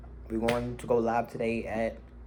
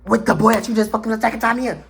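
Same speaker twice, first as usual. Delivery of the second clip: loud, higher-pitched accusation; acted-out yelling; 9 semitones higher, 8 dB louder than usual. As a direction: replay yelling down the phone. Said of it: "I'm yelling".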